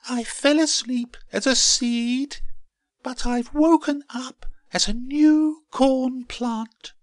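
very high, loud squeaky plant voice; hammed-up high-pitched marvelling; amazed little plant. A narrator's reading. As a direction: exclaim in wonder, pitched right up. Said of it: "she exclaimed".

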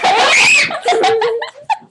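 high shrieking laugh; loud laugh. Real, not acted. Bursts out laughing.

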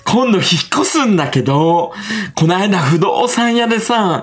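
high, slow, theatrical stage-actor delivery; [name]. Retold or imitated. Mama telling listeners about the estate agent, hammed-up stage voice. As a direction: imitate a troupe actor projecting every phrase, drawn out. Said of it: "劇団みたいな喋り方するから".

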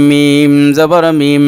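মিম held long with a humming nasal ghunna. Shown as a demonstration. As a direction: hum the মিম through the nose, drawn out. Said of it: "নুনে মিমের তাসদিদ গুণনা করা ওয়াজিব". (মিম-এ গুন্নাহ টেনে নাকে উচ্চারণ)

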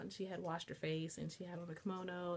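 normal talking voice

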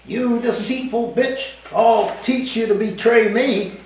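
high, angry yelling husband; furious high-pitched yell at his wife. A narrator's reading. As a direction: lash out at the betraying wife. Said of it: "I heard Forez yelling".

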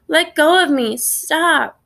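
high, protesting cry; narrator's restrained scream; mild scream. A narrator's reading. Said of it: "he's screaming his head off".

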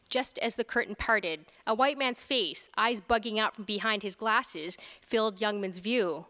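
ordinary delivery, nothing marked

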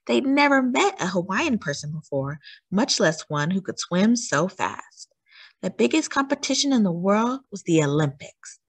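ordinary delivery

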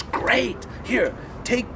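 high, punchy mock delight; delighted approval. Director approves actor's voice, excited. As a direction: imitate a director pleased with the answer.

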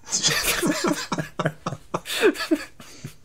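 squealing high laugh at own joke; giggling fit; high-pitched laugh. Real, not acted. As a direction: crack up at your own pun.

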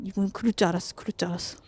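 low, soft resigned mutter; panicking gamer. Real, not acted. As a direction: mutter it, shaken. Resigned after scare. (낮고 작게 체념한 목소리)

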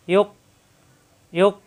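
ইয়োক cut short with a catch in the throat; hard stop. Shown as a demonstration. Teacher demonstrating a hard, clipped ইয়োক. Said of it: "সিফাতে সাদিদা".

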